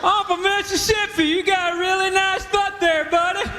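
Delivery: put-on high, drawn-out Southern good-old-boy accent; mock Southern drawl. Mississippi guy coming on to Rick, mock Southern drawl. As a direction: ham up a hearty Mississippi drawl.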